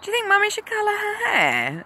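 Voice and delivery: high, quick, teasing question; high sing-song mother's question; playful mum to kids. Retold or imitated. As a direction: ask it like a game to small children. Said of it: "And so I said to them".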